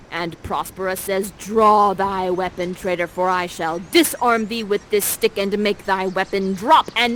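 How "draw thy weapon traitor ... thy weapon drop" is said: commanding challenge. Acted. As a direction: declaim it like a duel challenge. Prospera challenges Ferdinand.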